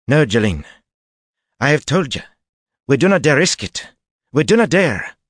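put-on tense Illianer accent, high, loud; strong Illianer accent, pleading. A narrator's reading. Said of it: "her Illianer accent strong".

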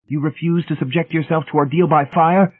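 high, portentous challenge; pitched right up, weighty question; significant inquiry. A narrator's reading. Brady challenging Hamilton's refusal, portentous. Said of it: "Brady inquired significantly".

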